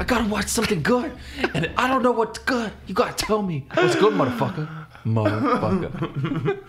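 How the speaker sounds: high-pitched pleading threat; hammed-up squeaky thug; menacing high voice. Joining knife threat bit.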